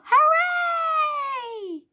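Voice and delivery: very high, loud hooray; cheering. Acted. Everyone cheering the exploded spaceship, high loud cheer. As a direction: cheer with full joy.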